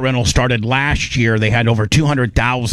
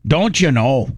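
higher mock Canadian greeting; a friendly Canadian "don't you know"; Canadian accent; 5 semitones higher, slower than usual. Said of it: "That's like saying".

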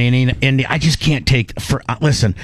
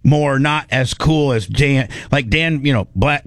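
normal talking voice

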